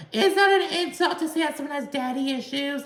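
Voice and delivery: very high-pitched mimicked interjection; mocking imitation. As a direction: put on a high voice to mock a bystander's question. Mimics Jennifer chiming in from background.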